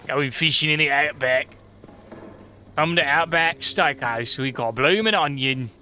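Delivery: put-on slow, drawn-out joke Australian accent; comedic Australian accent. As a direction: ham up the Australian like an advert. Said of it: "I can only do a comedic Australian accent".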